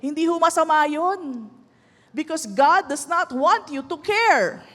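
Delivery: ordinary delivery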